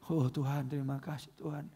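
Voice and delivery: quiet acted devotion; moved prayer. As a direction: murmur a grateful prayer. Acts tearful roadside prayer.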